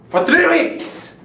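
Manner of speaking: ordinary delivery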